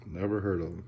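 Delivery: normal talking voice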